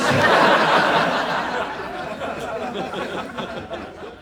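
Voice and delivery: long breathy laugh. Real, not acted. Comic laughing at robots line, long laugh.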